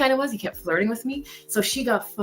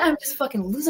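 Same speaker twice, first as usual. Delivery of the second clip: ordinary talk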